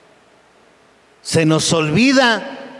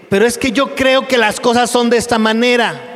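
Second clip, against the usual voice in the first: stubborn believer; 7 semitones higher than usual. Acted.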